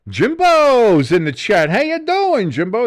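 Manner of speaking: loud, high, drawn-out announcer-like greeting; big stretched-out hype welcome; hyped shout-out. Real, not acted. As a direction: hail a new arrival at full volume. Hails viewer joining chat.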